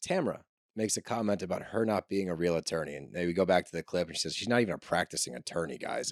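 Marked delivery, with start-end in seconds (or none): 4.3-6.1 condescending. Retold or imitated. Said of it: "she says it with a condescending tone".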